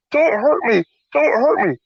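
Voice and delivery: high, repeated mock begging; mock frightened plea. Storyteller as thief walking out hands up, mock pleading. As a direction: imitate a scared thief surrendering.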